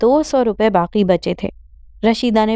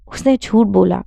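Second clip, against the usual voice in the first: plain reading voice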